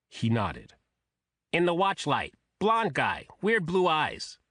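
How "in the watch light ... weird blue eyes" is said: high, quacking nasal voice. A narrator's reading. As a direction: honk it through the nose like a duck. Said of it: "in that nasal quacking voice".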